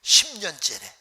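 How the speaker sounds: usual speaking voice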